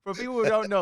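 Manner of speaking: high laughing recollection. Real, not acted. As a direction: laugh through the words. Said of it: "laughing at memory".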